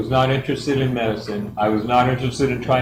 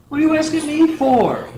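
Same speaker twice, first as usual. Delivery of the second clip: much higher, disbelieving squeak; incredulous; 4 dB louder than usual. Retold or imitated. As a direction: blurt it out in total disbelief. Baffled by stranger's tumor request.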